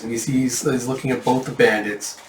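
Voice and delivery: ordinary delivery, nothing marked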